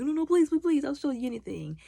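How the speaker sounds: high-pitched, hurried begging; mock a tough guy suddenly begging for mercy; imitated desperate plea; humbled pleading. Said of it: "he became real humble after that".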